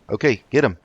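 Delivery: sudden burst of combat excitement; excited urging. Real, not acted. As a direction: get them fighting, keyed up. Combat starts, urging followers on.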